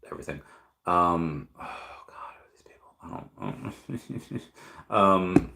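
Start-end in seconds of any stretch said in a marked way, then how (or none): 1.6-4.4 hushed, startled whisper. Real, not acted. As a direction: whisper it in disbelief. Startled whisper at chat names.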